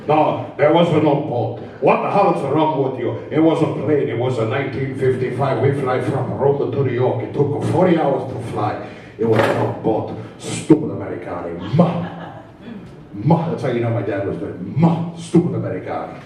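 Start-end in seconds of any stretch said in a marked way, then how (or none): 0.1-13.5 put-on thick Italian accent, gruff and scolding; Italian dad accent. Father scolding his American son, thick Italian accent. 14.7-16.1 put-on thick Italian accent, gruff and scolding; Italian dad accent. Father scolding his American son, thick Italian accent.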